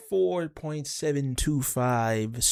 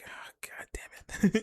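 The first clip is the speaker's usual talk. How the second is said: unvoiced, slow whisper of disappointment; whispered frustration; 5 dB quieter, slower than usual. Sif whispering her frustration at Thor. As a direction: breathe it out under your breath.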